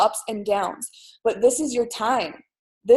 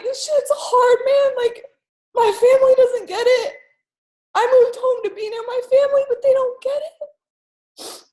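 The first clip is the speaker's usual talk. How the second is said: tearful, high-pitched plea through sobs; crying; 11 semitones higher, 5 dB louder than usual. Retold or imitated. Niece confiding in her uncle, crying. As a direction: choke it out through tears, much higher. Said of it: "I just started crying".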